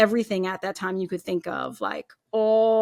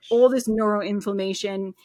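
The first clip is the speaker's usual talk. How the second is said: ordinary talk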